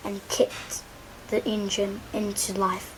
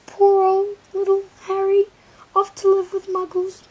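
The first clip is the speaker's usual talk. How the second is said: much higher, loud blubbering wail; 11 semitones higher, 9 dB louder than usual. Acted. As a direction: wail it out in grief. Hagrid wailing in grief.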